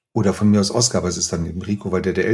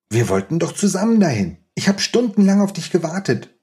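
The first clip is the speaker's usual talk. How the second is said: put-on reproachful child's voice rising higher; hurt boy; 8 semitones higher than usual. Oskar complaining to Rico that he waited hours, hurt and higher. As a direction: let the boy's hurt climb up as he complains.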